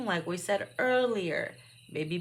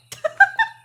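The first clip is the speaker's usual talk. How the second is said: sudden shrill laugh; loud high laugh; 12 dB louder than usual. Real, not acted. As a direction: burst of high shrieking laughter. Loud laugh at own joke.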